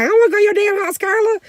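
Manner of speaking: high, loud mock whine of refusal; exasperated refusal. Mom refusing her sister's invitation, high exasperated voice.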